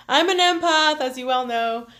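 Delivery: half-laughing, half-crying after getting emotional. Real, not acted. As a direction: push the words out on a teary laugh. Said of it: "sorry, I'm getting all emotional".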